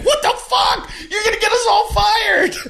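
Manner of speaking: high laughing shout of alarm; laughing mock shout. Temps shout at a coworker, laughing and high-pitched. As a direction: yell it while cracking up.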